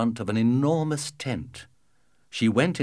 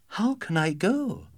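put-on young girl voice; 10 semitones higher than usual. Daughter voiced higher.

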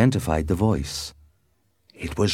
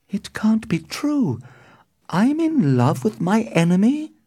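high, disbelieving, starting near a whisper; high dismay, thin then fuller; distressed cry. A narrator's reading. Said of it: "cried Juliet".

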